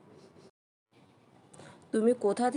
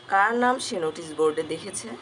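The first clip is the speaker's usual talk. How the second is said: usual speaking voice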